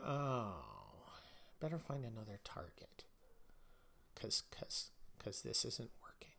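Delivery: whispered mock retreat; hushed, whispered mock backing off. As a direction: whisper it like a scared schemer. Imitating cowed activists retreating.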